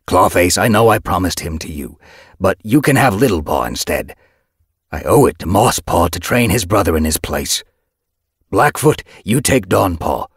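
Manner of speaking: loud, projected orders; commanding address. A narrator's reading. Leader assigning mentors to apprentices, commanding. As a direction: hand out assignments to a crowd.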